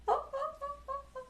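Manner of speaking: high, bright burst of giggling; real giggle. Real, not acted. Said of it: "just got me giggling".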